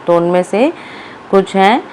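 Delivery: normal talking voice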